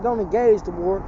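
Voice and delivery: usual speaking voice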